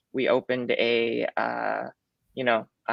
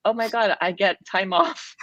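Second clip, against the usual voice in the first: excited; 8 semitones higher than usual. Retold or imitated. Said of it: "my first thoughts was".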